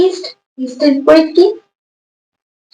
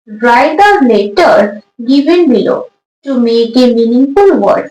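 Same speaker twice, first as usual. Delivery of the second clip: slow dictation; much slower than usual. Shown as a demonstration. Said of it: "teacher reads the question aloud".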